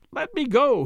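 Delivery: urgent high-pitched cry; shouted plea. A narrator's reading. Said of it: "he shouted".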